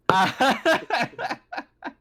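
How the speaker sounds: loud, high-pitched cackle; bursting laugh. Real, not acted. Game master cracking up at the table, real high laughing fit.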